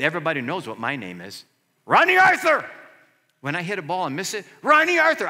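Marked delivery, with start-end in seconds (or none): 1.9-2.7 loud high yell of his own name; mock frustrated yell. Pastor acting out yelling on the court, loud mock outburst. Mock-cursing on a missed ball. 4.6-5.3 loud high yell of his own name; mock frustrated yell. Teammates mocking his missed shot, loud yell. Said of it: "I heard it the other day".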